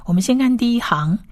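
ordinary talk, nothing marked

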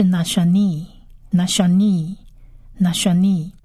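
"nashani" slowly as na-sha-nee; model pronunciation. Shown as a demonstration. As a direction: repeat "nashani" with gaps and a held final ee. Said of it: "第二个字是".

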